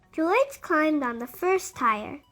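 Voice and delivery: usual speaking voice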